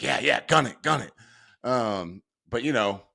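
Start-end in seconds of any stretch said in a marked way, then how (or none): 0.0-1.1 put-on eager old dad. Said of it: "he would have been like".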